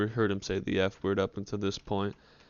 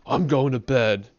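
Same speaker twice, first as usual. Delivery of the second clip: louder, clipped announcement; mildly angry dad signing off; curt dad; 4 semitones higher, 9 dB louder than usual. Retold or imitated. Dad storming off to bed, a louder curt announcement. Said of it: "He's like".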